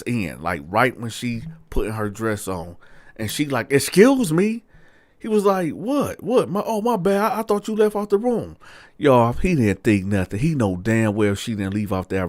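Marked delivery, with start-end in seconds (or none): 3.7-4.6 high, loud indignant objection; acted-out affront, pitched right up. Woman acted, walked in on dressing. 5.7-8.6 high-pitched oops, playing innocent; feigned innocence. Man feigns innocence when caught.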